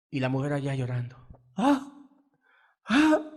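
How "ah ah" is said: grito agudo y fuerte de dolor; grito de llanto imitado. Retold or imitated. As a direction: imita el lamento de la madre. (madre que llora al ver pegar al hijo, grito agudo)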